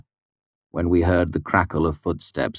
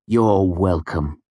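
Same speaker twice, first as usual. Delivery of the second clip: injured dignity; 3 semitones higher, slower than usual. A narrator's reading. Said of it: "with injured dignity".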